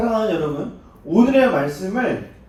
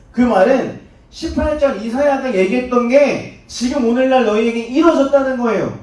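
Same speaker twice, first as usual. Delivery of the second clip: preaching; 7 semitones higher, 7 dB louder, slower than usual. Acted. Preacher drives home fulfilment.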